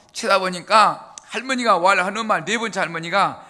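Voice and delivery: normal talking voice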